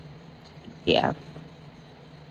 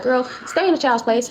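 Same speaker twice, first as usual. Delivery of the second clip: higher, dismissive mama voice; mom brushing her off; 10 semitones higher than usual. Retold or imitated. Mother on the phone telling her daughter to butt out, higher dismissive voice. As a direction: shut the kid down, lightly voiced mom.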